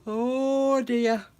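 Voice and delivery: words pulled long through a yawn. Real, not acted. Yawns on air.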